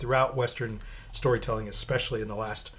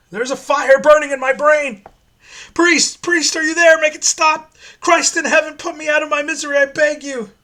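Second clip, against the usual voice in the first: much higher and louder, desperate pleading screams; condemned man howling for the priest to end it; screaming in agony; 16 dB louder than usual. Acted. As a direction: shriek like your brain is on fire. Prisoner's agony as box opens.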